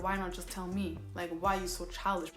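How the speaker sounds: normal talking voice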